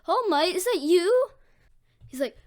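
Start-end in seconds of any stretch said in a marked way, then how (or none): 0.1-1.3 high, loud fan gushing; starstruck excitement. Acted. Deku meeting All Might, starstruck. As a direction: gush loudly at your hero. Deku meets his idol.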